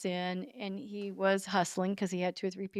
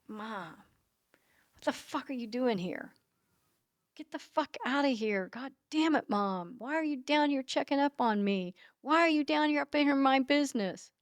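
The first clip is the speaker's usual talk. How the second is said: imitating annoyed son, higher-pitched; retold exasperation; 6 semitones higher than usual. Mother imitates son caught at gas station, exasperated higher protest. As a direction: protest in a raised pitch without shouting. Son upset at mom showing up.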